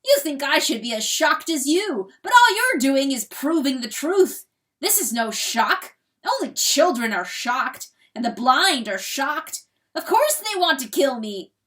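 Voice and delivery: triumphant boast. A narrator's reading. Rooster boasting his might to hen, loud triumph. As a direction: thunder his own power in triumph.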